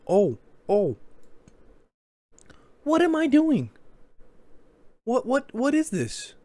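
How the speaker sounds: high, disbelieving squeal at himself; high mock disbelief at his own goaltending; incredulous. Real, not acted. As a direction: react as if he can't believe his own play. Shocked at own bad goaltending.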